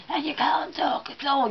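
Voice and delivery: hoarse whisper, feigning a throat infection; put-on voiceless croaky whisper; pretend lost voice. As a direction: whisper as if your voice has gone. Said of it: "pretend like you've got ... a throat infection".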